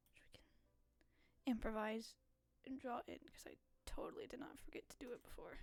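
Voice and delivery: real whisper, fast at the end; whispered aside. Real, not acted. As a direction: whisper it like a guilty secret. Sneaky aside while drawing.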